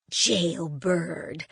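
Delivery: low, dismissive scoff at the word Jailbird. Acted. Grandmother mocking the label Jailbird, scoffing. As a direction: brush off the word Jailbird with scorn. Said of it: "scoffed my grandmother".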